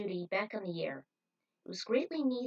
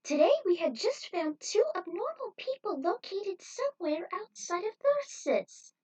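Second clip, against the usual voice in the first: much higher, loud announcer voice, slowing at the end; TV host voice; 12 semitones higher, 5 dB louder than usual. Acted. Cowboy host announces the new bounty, high and loud. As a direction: redo it as a high-pitched cowboy presenter. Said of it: "Well, let me try again".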